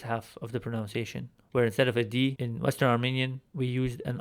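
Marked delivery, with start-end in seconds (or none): none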